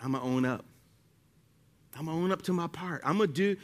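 quiet, low humble owning up; humble admission. Retold or imitated. Pastor voicing humility owning up to a spouse, quiet and low. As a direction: own it softly and meekly. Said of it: "Humility on the other side says".

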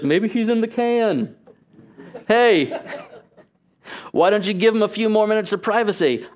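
high-pitched, hammed-up jeering; middle-school mockery. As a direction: heckle like a cheeky schoolboy. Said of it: "has the sensibilities of a middle school boy".

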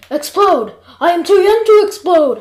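loud, high outburst of alarm; panicked yell. A narrator's reading. Jeff learns the ship could explode, panicked yell. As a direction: yell it in horrified disbelief. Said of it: "I yelled".

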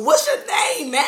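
high-pitched excited question. Real, not acted. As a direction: ask the name all hyped up. Host asks guest name excitedly.

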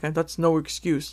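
a hint of a cold, dismissive scold; ruthless mock quote. Imagined ruthless critic, a hint of dismissive scolding. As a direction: brush off their excuse, lightly voiced. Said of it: "I'm not that ruthless".

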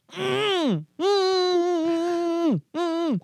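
high muffled hums through a full mouth; mm-yelling at geese with a gagged mouth. Retold or imitated. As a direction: try to yell with your mouth stuffed. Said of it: "trying to, like, yell at the geese, but my mouth is full".

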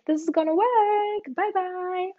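high, loud sing-song "This is gonna work"; same high pitch. Shown as a demonstration. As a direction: keep it bright and high like a blessing. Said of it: "you're gonna have the same pitch".

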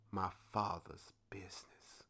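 a reverent whisper as Jesus speaks; hushed whisper. Acted. Jesus quoted, voice drops to whisper.

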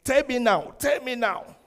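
loud, high-pitched insistent demand; demanding spouse. Acted. Spouse pressing a partner for an answer, loud and insistent. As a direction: imitate a spouse demanding an answer at full volume. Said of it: "some spouse will say".

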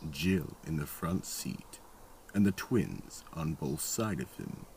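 normal talking voice

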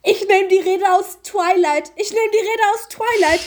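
high-pitched, giddy announcement; gleeful declaration. Pretend. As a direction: announce a silly brainwave, tipping into laughter. Hits on an absurd speech plan. (aufgedreht, mit hoher Stimme)

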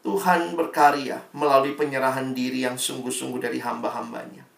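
usual speaking voice

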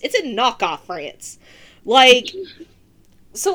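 normal talking voice

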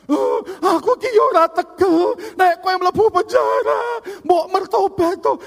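high, loud wailing plea; mock weeping father. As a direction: bawl through the words like a heartbroken dad, hammed up. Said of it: "nangis-nangis".

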